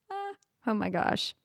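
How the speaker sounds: usual speaking voice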